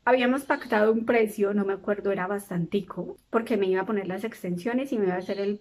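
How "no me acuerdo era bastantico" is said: ordinary delivery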